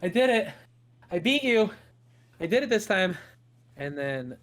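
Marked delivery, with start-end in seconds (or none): 0.0-3.2 breathless high, loud sister crowing in triumph; out of breath. Acted. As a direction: gasp out the win, high and loud. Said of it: "she's out of breath and she's like".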